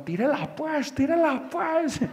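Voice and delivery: high, strained hushed urging; a nervous kid begging him to throw it, high and tense. Retold or imitated. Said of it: "Está diciendo".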